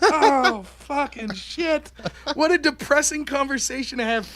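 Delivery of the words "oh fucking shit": high, long-drawn comic dismay; retold dismay. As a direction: stretch it out as hammed-up despair. Dismayed reaction to son's fear.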